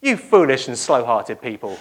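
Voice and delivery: chuckling through the scolding; laughing rebuke. Retold or imitated. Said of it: "he says".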